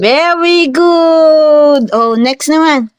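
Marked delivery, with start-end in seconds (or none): 0.0-1.9 very slow, higher-pitched praise; drawn-out praise. Real, not acted. Praising the child's attempt. (mabagal at pahabang papuri)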